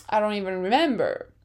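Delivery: normal talking voice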